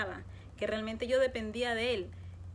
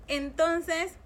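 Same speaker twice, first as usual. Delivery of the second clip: ordinary talk, nothing marked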